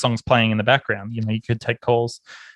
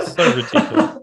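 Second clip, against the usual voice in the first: laughing. Real, not acted. Laughing at memory.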